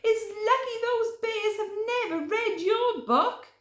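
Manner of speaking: high, loud hurry; urgent. Acted. Podgy pulling Hare away, high urgent voice. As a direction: urge Hare to flee.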